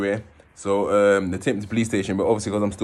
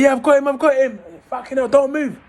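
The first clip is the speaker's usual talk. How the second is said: high-pitched, urgent mock officer yell; police shout; 4 dB louder than usual. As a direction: call out like a cop who's found the suspect. Policeman spots him in garden.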